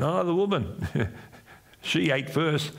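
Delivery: hammed-up blame shifting; whiny excuse. Preacher voicing Adam blaming the woman, mock whiny.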